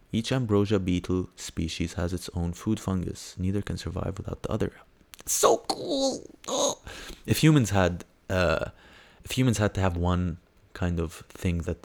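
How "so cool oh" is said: very high-pitched gushing delight; high fangirl squeak; excited squeal. Real, not acted. Excited reaction to beetle article.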